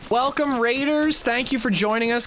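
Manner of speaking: high, bright streamer greeting; excited welcome. Real, not acted. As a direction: welcome them in with a big lift. Greeting incoming raiders.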